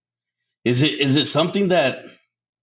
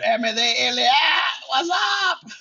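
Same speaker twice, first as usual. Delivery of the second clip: yelling across the room; 12 semitones higher, slower than usual. Retold or imitated. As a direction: call out to her across the living room. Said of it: "I fucking yell at her".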